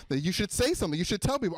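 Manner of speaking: ordinary talk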